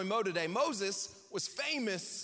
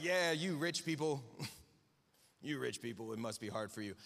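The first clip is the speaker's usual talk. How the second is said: mock smug; 8 semitones lower, 4 dB quieter than usual. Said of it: "we're like".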